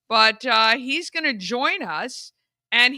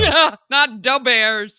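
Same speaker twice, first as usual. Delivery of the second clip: thick Chicago "Da Bears"; Chicago accent; 7 dB louder, slower than usual. Shown as a demonstration. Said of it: "but La Bears".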